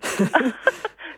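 short rising laugh; demo laugh. Shown as a demonstration. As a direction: laugh invitingly to the audience. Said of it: "Lacht doch mal alle da draußen". (kurzes einladendes Lachen)

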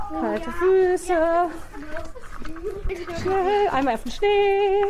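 high, excited cries in the snow; excited in the cold. Real, not acted. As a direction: squeal it out over cold feet. Barefoot in the snow outside.

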